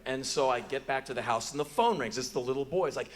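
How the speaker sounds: normal talking voice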